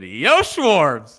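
loud, high call-out; big bro-style shout; retold hearty hail. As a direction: holler it like greeting a buddy. Retells hailing a player.

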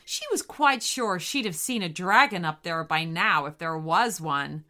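usual speaking voice

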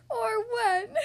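high, loud hammed-up wail; sobbing. Mister Nobody reaching his breaking point, sobbing theatrically. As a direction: break into an acted sob. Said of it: "he sobbed".

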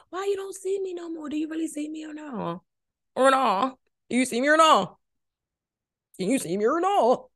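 mock needy caller. Mock voice of late-night caller.

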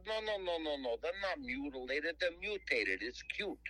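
put-on high, insistent New Jersey accent; Jersey guy. Jersey caller insisting the turtles are cute, high Jersey accent. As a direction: correct him fast in a pushy New Jersey voice. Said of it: "he talks just like a guy from Jersey".

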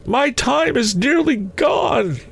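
very high, slow spectral wail; urgent ghost. A narrator's reading. Ghost warning that time is short, urgent high wail. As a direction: call it out high and drawn, time running out.

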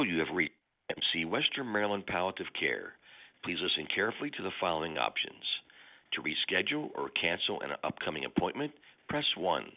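usual speaking voice

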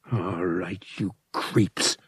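low, soft menacing mutter. A narrator's reading. Han targeting the pirates, soft muttered threat. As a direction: growl it under your breath. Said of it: "Han muttered".

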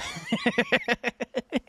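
high chuckle at yourself; amused laugh. Real, not acted. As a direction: laugh at your own cowardice. Laughs at his own failed bravery.

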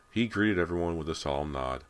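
usual speaking voice

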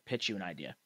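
ordinary delivery

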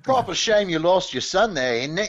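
mock-British commiseration; British accent. Host spoofing the show's sad scene, mock British accent. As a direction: offer condolences as a parody Brit. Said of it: "British accents".

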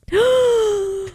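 sharp high gasp of shock. Real, not acted. Co-host recalls seeing the coat dress, high shocked gasp. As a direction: gasp as if you can't believe it.

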